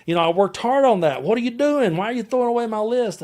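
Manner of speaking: retold angry outburst, pitched high; upset outburst. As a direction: relive the frustration at the torn set list. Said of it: "I was so upset at him".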